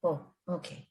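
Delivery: ordinary delivery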